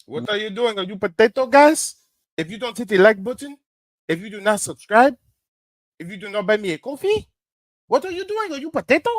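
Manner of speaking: high, loud mock rant that climbs; hammed-up imitation of an angry streamer yelling at chat; mocking the streamer's rant. Co-host mocks streamer's rant.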